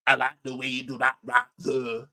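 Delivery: mocking Lancashire accent, lightly put on; a hint of Lancashire, teasing; imitating Lancashire accent. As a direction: parrot the YouTuber's Lancashire accent to mock him. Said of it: "Such a strong West London accent".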